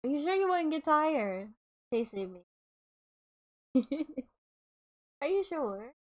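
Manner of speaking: usual speaking voice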